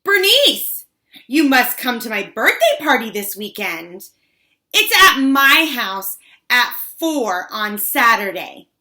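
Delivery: loud, high, showy invitation; booming birthday invite for the whole room's benefit. A narrator's reading. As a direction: announce it so everyone for miles can hear. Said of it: "in a voice loud enough".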